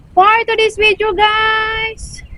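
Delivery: high, drawn-out vlogger intro. Acted. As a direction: sing out the intro to viewers. Vlogger's performed intro line.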